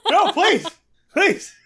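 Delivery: very high, loud mock begging; squealed comic plea; hammed-up pleading. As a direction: beg desperately, playing it for laughs. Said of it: "Phil here is pleading".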